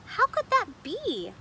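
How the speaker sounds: high, slow, wondering question; puzzled. Acted. Grandmother puzzled at the candy, high and slow. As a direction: puzzle it out aloud. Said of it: "Rosa Maria puzzled".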